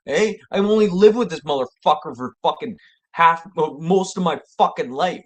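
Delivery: high, loud heated rant at the friends; worked-up rant. Retold or imitated. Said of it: "I was like".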